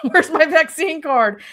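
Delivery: high, loud, laughing cry of alarm; frantic retold outburst. Teller replays hunting for her vaccine card, mock-frantic. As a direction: blurt it out in a mock panic.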